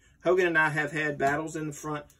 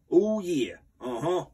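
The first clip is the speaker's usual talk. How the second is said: higher and louder, drawn-out signature "Ooh, yeah"; Macho Man catchphrase; 3 semitones higher, 4 dB louder, slower than usual. Acted. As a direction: sell it like a wrestling promo.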